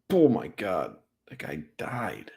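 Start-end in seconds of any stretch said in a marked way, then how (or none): 1.3-2.3 hushed, breathless whisper. Real, not acted. Stunned by the hit on replay, whispered awe.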